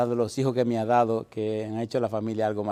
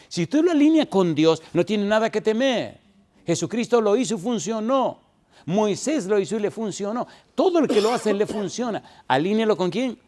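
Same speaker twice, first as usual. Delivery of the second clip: full-force preaching; 8 semitones higher than usual. Acted. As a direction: drive it home to the congregation.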